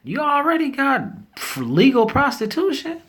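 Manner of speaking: high squeaky disbelief; high-pitched what-are-you-doing disbelief. Real, not acted. Disbelief at legal prostitution.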